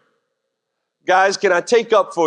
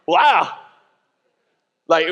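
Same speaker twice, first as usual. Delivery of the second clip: usual speaking voice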